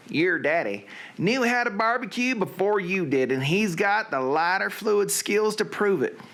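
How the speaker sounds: high-pitched Southern storyteller; hammed-up Southern recitation. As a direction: read it out in a put-on Southern accent. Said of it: "to do in a Southern accent".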